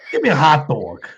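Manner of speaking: put-on laughing through a Yonkers accent "hot dog". Mocking his wife's Yonkers accent, laughing. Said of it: "that's how they say it".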